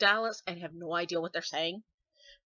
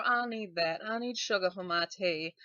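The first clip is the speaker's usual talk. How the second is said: cliche southern. Shown as a demonstration. Giving viewers examples of cliche southern phrases, southern accent. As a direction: lay on a stock southern accent. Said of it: "something that is cliche southern".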